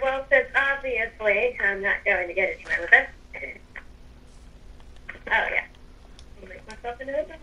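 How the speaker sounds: usual speaking voice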